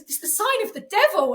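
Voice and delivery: spooked warning. Retold or imitated. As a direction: lean on devil as if spooked. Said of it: "She goes".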